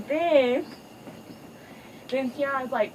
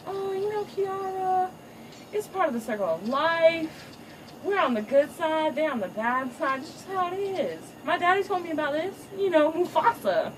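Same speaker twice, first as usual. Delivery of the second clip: higher, preachy father voice; patronizing dad; 5 semitones higher than usual. Retold or imitated. Father lecturing daughter about circle of life, patronizing and high. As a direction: lecture her like a know-it-all dad.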